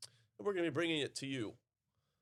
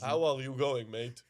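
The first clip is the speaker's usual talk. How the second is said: a louder mock Hungarian accent on an Aussie greeting; hammed-up Hungarian accent, the opening punched louder; the Hungarian yoga teacher greets in a mock Hungarian accent; thick Hungarian accent; 7 dB louder than usual. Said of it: "coming from a thick Hungarian accent".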